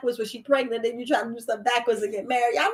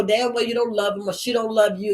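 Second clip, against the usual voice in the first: ordinary delivery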